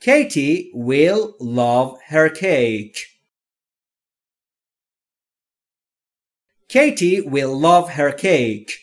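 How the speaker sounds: very slow, careful dictation read; each word spaced out and clear; slow model reading. Shown as a demonstration. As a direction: read it slowly for learners to copy. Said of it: "حالا نوبت شماست".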